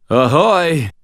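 put-on high, loud drunken holler; tipsy sailor's shout; drunken slur. A narrator's reading. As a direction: slur it out like a merry drunk calling across water. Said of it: "I slurred drunkenly".

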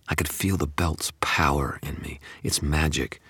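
usual speaking voice

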